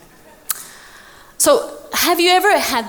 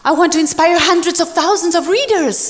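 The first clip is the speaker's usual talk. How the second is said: eager protest; 6 semitones higher, faster than usual. Retold or imitated. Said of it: "And I go".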